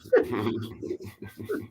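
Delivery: high burst of laughter; real laugh after the joke. Real, not acted. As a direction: laugh at the punchline. Laughing at own anecdote.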